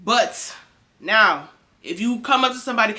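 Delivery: ordinary talk, nothing marked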